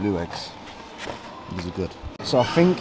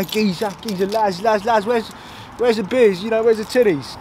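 higher, put-on lads' banter; southern geezer impression; 8 semitones higher than usual. Contrasting the north with down south, high mock-geezer voice.

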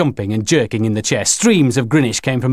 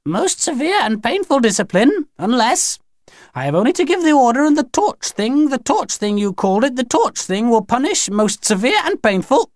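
put-on very high-pitched threatening old alien; clear, expressive Grinny; 13 semitones higher than usual. A narrator's reading. Grinny threatens the children with punishment, high and expressive. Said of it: "much more clearly and with expression in her voice".

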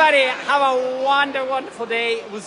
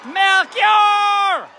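calling out the name like a football announcer; high, drawn-out stadium call; 7 semitones higher, 4 dB louder than usual. Real, not acted. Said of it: "I sound like a football announcer".

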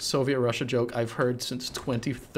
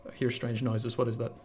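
noir detective; 4 semitones lower, 8 dB quieter than usual. Real, not acted. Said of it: "made you sound like a noir detective".

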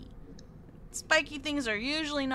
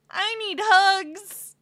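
whiny plea; 7 semitones higher, 9 dB louder, much slower than usual. Real, not acted. Pleading for comfort.

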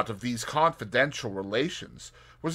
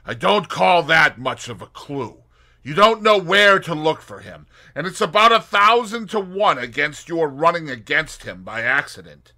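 Tommy voice; 6 semitones higher, 11 dB louder than usual. Acted. Tommy doubting the plan.

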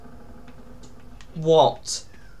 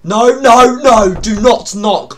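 loud, raised-pitch protest, easing off; panicked shout; 5 semitones higher, 14 dB louder, slower than usual. Real, not acted. Player begs whoever is knocking to stop, panicked shout. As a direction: shout them away from the door in panic.

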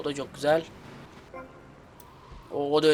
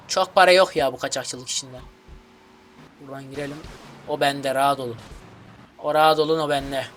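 usual speaking voice

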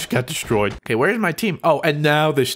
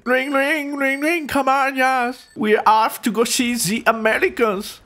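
put-on high-pitched, singsong German accent; mock German accent; 7 semitones higher than usual. Gamer cycling off to the Americans, mock German accent. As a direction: ring out brightly in put-on German. Plays German soldier on bicycle.

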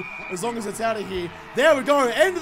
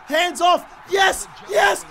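much higher, louder cheering; excited yell; 8 semitones higher, 5 dB louder, slower than usual. Real, not acted. Gamer screaming his player through on goal, ecstatic yell. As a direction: roar your player home.

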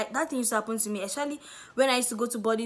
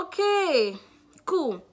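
much higher, louder bright okay; relieved chirpy okay; 6 semitones higher, 5 dB louder, much slower than usual. Retold or imitated. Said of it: "I was like".

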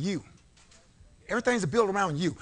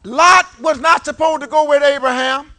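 fiery preaching; 6 semitones higher, 11 dB louder than usual. Real, not acted. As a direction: preach it with full force. Preaching at full force.

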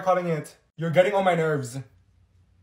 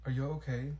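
usual speaking voice

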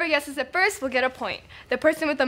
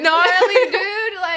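surfer dude; 2 semitones higher, slower than usual. Pretend. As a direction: stretch it out like a stoked surfer. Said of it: "Wait, the surfer boy?".

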